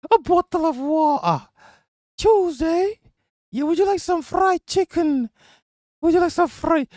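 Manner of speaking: very high-pitched British caricature; mock British accent. Streamer parodying British speech to explain a dislike, mock British. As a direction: put on a silly, piping British voice. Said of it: "He does have a British accent".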